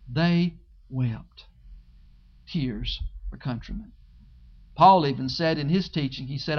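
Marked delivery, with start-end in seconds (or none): 0.1-3.9 softer, lower They wept; softer repeat. Shown as a demonstration. Pastor lingering on the men weeping, soft and low.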